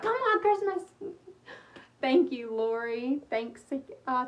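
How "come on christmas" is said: eager plea. Retold or imitated. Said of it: "I'm always like".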